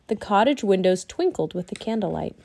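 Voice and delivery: ordinary delivery, nothing marked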